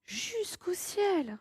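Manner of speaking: very high, slow, marvelling; admiring. Acted. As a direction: let "ciel" float up in wonder. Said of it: "Ici, Jack est admiratif".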